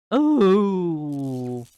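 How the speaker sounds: loud pained gasp; pained cry. Acted. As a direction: cry out as if hit. Struck, cry of pain.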